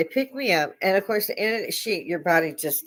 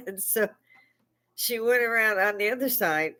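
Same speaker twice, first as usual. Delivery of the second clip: usual speaking voice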